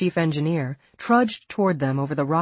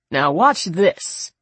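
slow, teasing; 5 dB louder, much slower than usual. A narrator's reading. Elkins showing off.

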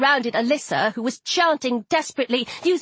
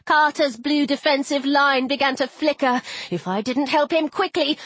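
ordinary delivery

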